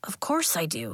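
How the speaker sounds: breathy whispered reply; hushed, almost under the breath; whispering. Acted. Elliot answers quietly.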